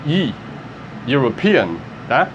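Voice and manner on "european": European started with a Y sound; English pronunciation. Shown as a demonstration. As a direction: glide into European with Y. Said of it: "É o som aqui de Y". (European pronunciado com Y no começo)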